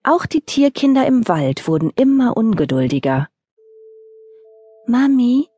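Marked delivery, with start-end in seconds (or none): 4.9-5.5 put-on little fox child. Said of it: "fragte das Füchschen".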